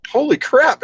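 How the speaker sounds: high, amazed exclamation; retold surprise at the news, high-pitched; stunned surprise. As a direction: gasp it out in disbelief. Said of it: "And I went".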